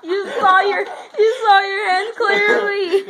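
high, loud laughing speech; laughing hard. Real, not acted. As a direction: talk through big laughter. Laughing at the flip.

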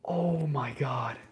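loud, slow, drawn-out awe; awestruck cry. Real, not acted. Streamer stares at the wanted card, loud slow amazement. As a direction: stretch it out in real disbelief. Overcome by rare card pull.

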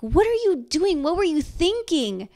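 exasperated shout. Retold or imitated. Parent shouting at children in frustration, loud exasperated yell. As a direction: shout at the kids in sudden annoyance. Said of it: "shouted in frustration or annoyance, even in surprise".

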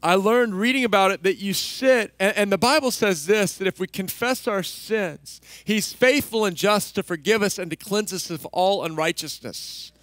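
ordinary talk

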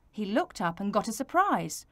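ordinary delivery, nothing marked

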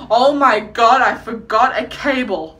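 loud mock panic at the airport; stressed panic. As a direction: act out a stressed traveller's sudden alarm. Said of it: "that already stresses me out".

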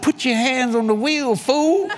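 high, insistent scolding command; bossy inner voice. Retold or imitated. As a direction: order a fool to pay attention. Acting out his mind's command.

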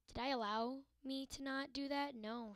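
ordinary talk, nothing marked

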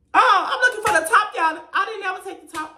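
high, loud burst of self-exasperation; flustered realisation. Real, not acted. Vlogger realising she never took the top, flustered. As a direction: blurt it out high, speeding up. Suddenly realising her search was pointless.

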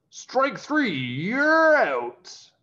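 high, loud strikeout call; umpire call. A narrator's reading. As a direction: call it like an umpire ending the game. Umpire calls the final strike.

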